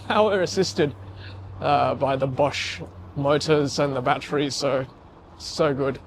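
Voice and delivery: ordinary talk, nothing marked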